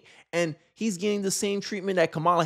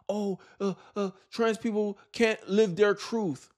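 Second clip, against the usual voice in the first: slow, higher-pitched mocking imitation; mock complaint; 4 semitones higher, slower than usual. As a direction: mimic their complaint in slow, drawn-out mockery. Mocking imagined commenters' complaints.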